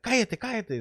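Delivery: high-pitched imitation of a woman's shut-up; telling him off. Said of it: "she was telling him to shut up".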